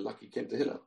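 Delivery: normal talking voice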